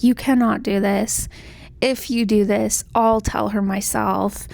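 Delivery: ordinary talk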